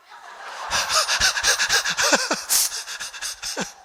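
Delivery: a breathy, high burst of laughter. Real, not acted. Preacher laughing at his own punchline, breathy high laughter. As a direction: cackle at your own punchline.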